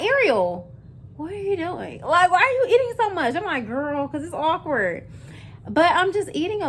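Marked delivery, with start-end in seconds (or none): 0.0-3.3 high-pitched, exasperated talking-to; mock telling-off, pitched way up; self-scolding. Acted scolding of herself. 3.7-5.1 higher, drawn-out answer back; mock-defensive, pitched up and slow; defensive comeback. Acted answer back to herself.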